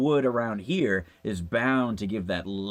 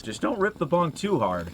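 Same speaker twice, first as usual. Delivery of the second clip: ordinary delivery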